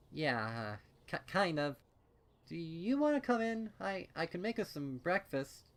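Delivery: lightly voiced shy mare, hesitant and sheepish; shy Daisy voice. Shy Daisy offers breakfast sheepishly.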